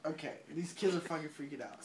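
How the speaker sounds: ordinary delivery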